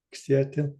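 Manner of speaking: usual speaking voice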